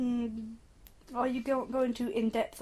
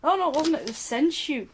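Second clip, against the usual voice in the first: usual speaking voice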